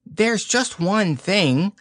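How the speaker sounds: high, slow, nervous little pig; a small anxious pig, hesitant; high fidgety Piglet. A narrator's reading. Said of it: "fidgeting a lot".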